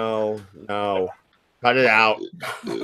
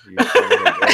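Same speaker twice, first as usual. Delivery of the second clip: laughing; faster than usual. Real, not acted. Laughing at the joke.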